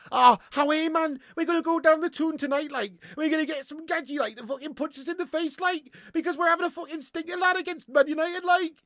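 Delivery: high, lilting attempt at Geordie; bad Geordie accent. Streamer mocking Newcastle fans, bad Geordie accent. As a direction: have a clumsy go at a Geordie accent. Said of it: "I can't really do the Geordie accent".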